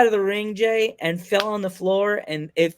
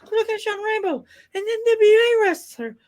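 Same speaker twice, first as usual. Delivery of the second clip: much higher, giddy boast to dad; freaking out. Retold or imitated. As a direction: squeal it like a thrilled kid. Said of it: "I was freaking out".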